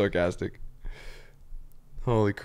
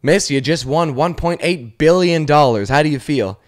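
loud, excited announcer; hyped-up mock presenter; lottery interviewer; 4 semitones higher, 6 dB louder than usual. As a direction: announce a huge win, slowly and loudly. Plays interviewer of a lottery winner.

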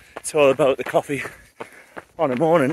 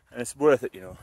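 ordinary delivery

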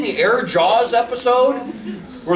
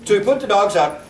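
usual speaking voice

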